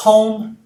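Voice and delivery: "Home" called out high and loud; emphatic home. Shown as a demonstration. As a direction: punch "Home" as the key word. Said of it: "um dos advérbios mais usados".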